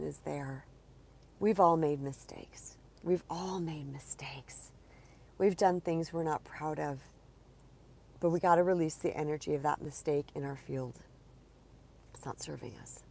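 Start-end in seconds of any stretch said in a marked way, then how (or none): 3.0-4.7 whispered repeat; gentle whispered echo. Shown as a demonstration. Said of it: "repeated as whisper". 12.1-13.0 low hushed whisper; quiet whispered aside. Real, not acted. Soft emphatic aside.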